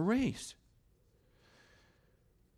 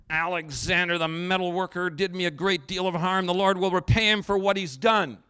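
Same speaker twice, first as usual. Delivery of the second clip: loud, raised outburst against a wrongdoer; indignant complaint; 4 semitones higher, 8 dB louder than usual. Acted. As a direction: denounce the man at rising volume. Acts out Paul's bitter complaint.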